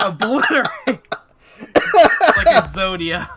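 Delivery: dramatic announcer. Pretend. Dramatic game boast.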